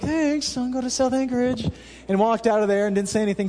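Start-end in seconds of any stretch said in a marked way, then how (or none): 0.0-1.7 higher, sweetly strained thanks; a touch of fake cheer; forced polite smile. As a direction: smile through the annoyance. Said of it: "I kinda smiled and I was like".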